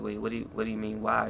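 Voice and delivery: hushed, puzzled comeback; quiet quick reply. Retold or imitated. Son answering mom softly.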